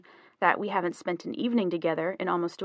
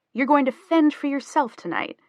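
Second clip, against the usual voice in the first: higher, louder, amused disbelief; teasing, raised-pitch surprise; grinning question; 7 semitones higher, 5 dB louder than usual. A narrator's reading. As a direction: ask it through a grin. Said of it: "she asked, grinning".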